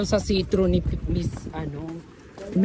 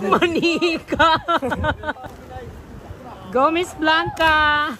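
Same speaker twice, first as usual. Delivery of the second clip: cheering; 6 semitones higher, much slower than usual. Real, not acted. Cheering friends on.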